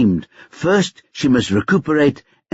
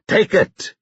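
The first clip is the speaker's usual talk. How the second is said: curt order; 8 semitones higher than usual. A narrator's reading. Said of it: "he realized how it sounded".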